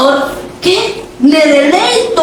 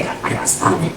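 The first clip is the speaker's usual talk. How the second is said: whisper; 10 dB quieter than usual. Pretend. Mock whisper about a disliked sister.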